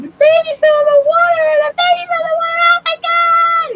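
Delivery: high, loud mock shriek of panicking relatives; panicked screaming. Italian relatives see the baby fall in the pond, panicked high screaming. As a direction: shriek it like frantic aunties watching a baby fall in. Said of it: "all the relatives start screaming".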